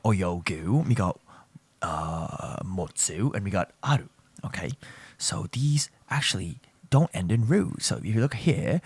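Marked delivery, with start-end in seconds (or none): 5.2-7.8 whisper. Real, not acted. Lesson line said unvoiced.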